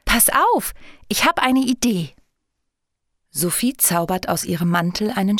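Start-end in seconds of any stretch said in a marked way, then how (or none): 0.0-2.1 high, excited fairy voice; gleeful, pitched-up exclamation; joyful call. A narrator's reading. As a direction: burst out happily with a sudden idea. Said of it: "Plötzlich ruft sie freudig".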